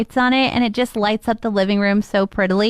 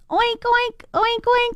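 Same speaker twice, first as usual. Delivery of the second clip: pig oinks; 11 semitones higher, much slower than usual. Acted. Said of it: "A pig".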